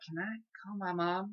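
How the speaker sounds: very soft, timid request; embarrassed. Retold or imitated. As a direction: ask under your breath, embarrassed. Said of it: "I was so embarrassed".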